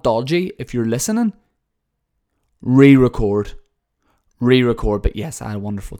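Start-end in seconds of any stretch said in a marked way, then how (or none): 2.6-5.0 shouted re-record, falling; loud demand. Real, not acted. Shouting at the band Dodgy to re-record. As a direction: call it out to the band. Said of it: "calling to the band".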